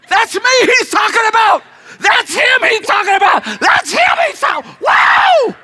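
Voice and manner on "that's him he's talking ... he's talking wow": ordinary talk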